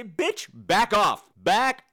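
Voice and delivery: loud, slow drawn-out bitch, back off; loud mock snap at a nursing mother; snapping at someone. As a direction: tell her off loudly and slowly. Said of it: "And I just wanna say".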